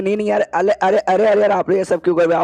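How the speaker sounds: mild flustered protest. Retold or imitated. Boy stopping the guests from doing his chores, mildly flustered.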